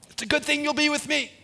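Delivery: high, quick reply; high-pitched quipping Moses; relieved aside. Acted. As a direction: toss it back fast and high, as Moses. Moses' quick aside back to God.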